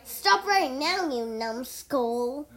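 loud, drawn-out yell; yelled command. A narrator's reading. Cole calls after the fleeing skeleton, loud slow yell. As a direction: yell it slowly after someone escaping. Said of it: "Cole yelled".

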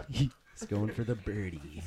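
golf commentator. Pretend.

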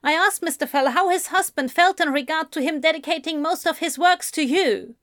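quick, high reporter voice; journalist repeating. Acted. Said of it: "the journalist repeated".

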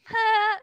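short, small high "huh"; small huff. Shown as a demonstration. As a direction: let out a modest little huff. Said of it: "it's not just like".

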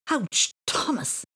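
muffled, slow pained voice; face in snow. A narrator's reading. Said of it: "trying to speak with his face in the snow".

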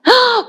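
a high longing "ah!" sigh; wistful sigh. Retold or imitated. People dreaming of the future let out a high sigh.

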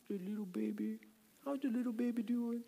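put-on high, cooing baby talk; newborn baby voice. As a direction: coo it like talking to a newborn. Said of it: "making that newborn baby voice".

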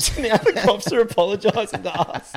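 real laughter breaking through the line; delighted laugh over the words. Real, not acted. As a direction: laugh it out, barely able to say it. Laughing at cops apologising.

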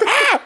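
high yelped scream; a scream from far across the map; distant scream emote. Retold or imitated. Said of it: "hear, like, a".

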